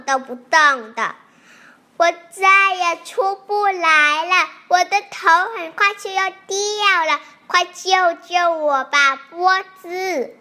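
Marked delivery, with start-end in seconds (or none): 2.0-10.4 high, loud pleading little calf; calling out loudly. A narrator's reading. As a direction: wail the plea at raised pitch and volume. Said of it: "小牛放声地叫起来".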